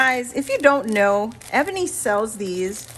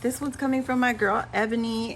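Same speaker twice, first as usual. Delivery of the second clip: ordinary talk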